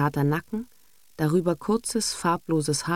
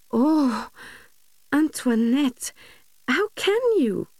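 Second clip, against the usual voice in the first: dismayed cooing; 10 semitones higher than usual. A narrator's reading. English governess cooing in dismay at Antoinette. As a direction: coo in gentle distress. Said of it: "säuselte die Miss bekümmert".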